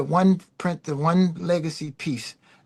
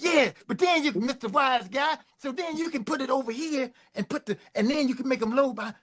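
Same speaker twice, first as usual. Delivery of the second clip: much higher, breathless string of demands; a boss rolling over objections; 9 semitones higher than usual. Retold or imitated. Prince keeps adding demands.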